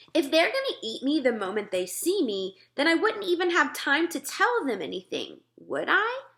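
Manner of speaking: put-on girl pressing her point; lightly voiced Sophie. Sophie arguing with the giant, lightly voiced girl.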